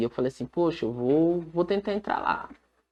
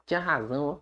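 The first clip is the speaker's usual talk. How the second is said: ordinary delivery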